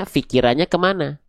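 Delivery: usual speaking voice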